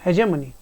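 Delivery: हेजेमनी, j in the middle, ending mani; correct pronunciation. Shown as a demonstration. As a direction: model word हेजेमनी, calm and clear. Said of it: "ये तो वैसे ही गलत है".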